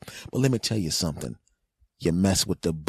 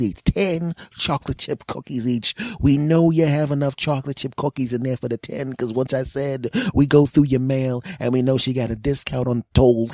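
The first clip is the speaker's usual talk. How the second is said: put-on pushy kid voice, raised pitch; lightly voiced demanding kid; a hint of a bratty neighbourhood kid; 6 semitones higher than usual. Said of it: "the ones I just pretended to be".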